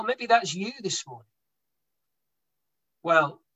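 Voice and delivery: ordinary talk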